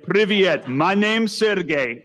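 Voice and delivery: slow, lightly voiced Russian greeting; a hint of a Russian accent; mock Russian accent. The Russian visitor introducing himself in a mock Russian accent.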